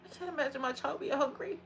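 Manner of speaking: quiet, pained crying; hurt, tearful. Real, not acted. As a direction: fight back real tears as you speak. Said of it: "That actually hurts".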